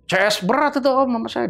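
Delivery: put-on high, surprised young voice; lightly voiced office boy recognizing the name; retelling the office boy's surprised answer; office boy's reply. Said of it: "dia bilang gitu kan".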